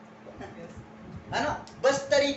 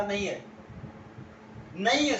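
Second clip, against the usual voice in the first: curt refusal; 3 semitones lower than usual. Retold or imitated. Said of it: "उन्होंने अकड़ कर कहा".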